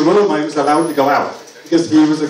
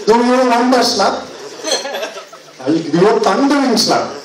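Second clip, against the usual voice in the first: mock dad threat; 6 semitones higher than usual.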